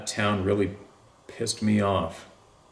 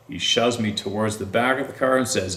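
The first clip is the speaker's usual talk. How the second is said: usual speaking voice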